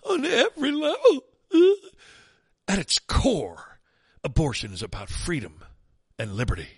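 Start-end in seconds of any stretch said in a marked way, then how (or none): 0.0-1.6 high, whiny echo of every level; whiny mock voice. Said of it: "in your best whiny voice". 2.7-3.8 slow, drawn out whiny echo; whiny mock voice. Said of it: "your best whiny voice".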